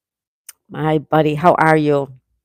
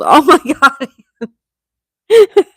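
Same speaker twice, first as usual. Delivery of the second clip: loud laughing oh my God, pitch jumping high; 7 semitones higher, 6 dB louder than usual. Real, not acted. Streamer laughing at a viewer's pimple complaint, loud real laughter. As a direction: burst out laughing through the words.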